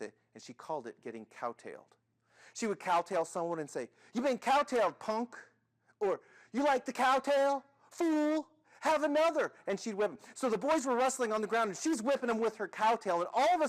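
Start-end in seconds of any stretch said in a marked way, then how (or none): 4.1-9.5 loud, high, cocky taunt; hyper, raised-voice challenge. Acted. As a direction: taunt them like a playground tough. Girl taunts her whipping victims.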